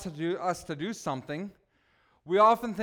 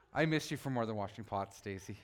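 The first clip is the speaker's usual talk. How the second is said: grieving; 8 semitones lower, 7 dB quieter than usual. Real, not acted. Grieving husband addresses late wife.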